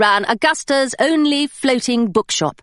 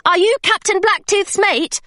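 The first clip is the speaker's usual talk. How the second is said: put-on young Hilary; 9 semitones higher than usual. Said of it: "she said".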